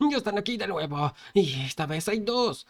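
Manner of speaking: high-pitched cry of alarm; cowards' cry. Acted. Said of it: "los cobardes otra vez gritaron".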